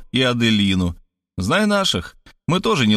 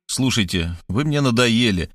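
usual speaking voice

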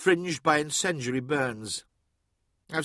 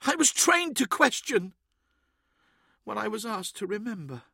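upset, high-pitched artificer; sobbing; 8 semitones higher than usual. A narrator's reading. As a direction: protest tearfully, then shrink to a small voice. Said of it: "she sobbed".

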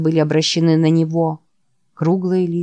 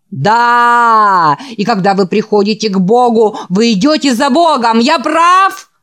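loud, high revival preacher in a fever; frenzied preaching; 5 semitones higher, 5 dB louder than usual. Acted. The feverish preacher dances on stage shouting at the boys. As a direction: drive the crowd into frenzy.

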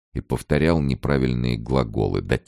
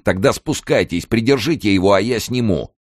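raised, impatient order; urgent command; 7 semitones higher than usual. A narrator's reading. Kern taking over from cellmate, urgent orders. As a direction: take charge quickly in a crisis.

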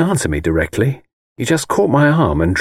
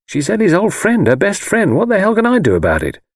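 higher-pitched exasperated protest; irritable; 10 semitones higher than usual. A narrator's reading. Husband defending himself over his wife's friend, irritably. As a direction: protest testily, pitch pushed right up. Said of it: "I said irritably".

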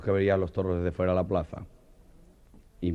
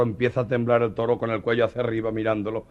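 usual speaking voice

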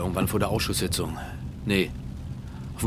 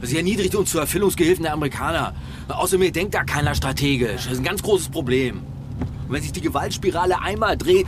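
heated rant; 6 semitones higher, 3 dB louder than usual. Acted. Angry rant about chancellor.

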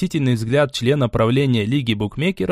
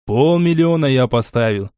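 ordinary delivery

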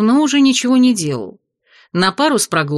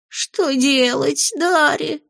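much higher, slow whiny lament; lamenting wail; 7 semitones higher, much slower than usual. Acted. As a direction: wail it like a panicked imp. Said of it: "запричитал бес".

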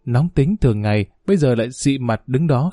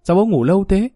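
lightly voiced little girl, higher pitch; innocent child's question; 6 semitones higher than usual. A narrator's reading. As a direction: ask father with carefree curiosity. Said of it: "bé Hạnh vô tư hỏi bố". (giọng bé gái vô tư hỏi)

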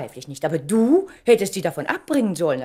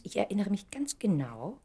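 whispered; 7 dB quieter than usual. Acted. Intimate recollection to Charles.

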